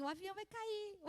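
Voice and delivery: put-on small, high, soft child's voice; scared child imitation. Nicolas looks at his mother in turbulence, imitated small scared voice. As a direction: imitate a trembling child's quiet dread. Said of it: "o Nicolas com as perninhas tremendo".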